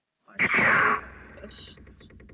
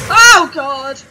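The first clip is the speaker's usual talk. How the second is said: very loud, high real yell; startled shout; 6 semitones higher, 24 dB louder than usual. Real, not acted. Player shocked by the game, startled shout. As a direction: blurt it out at full volume in shock.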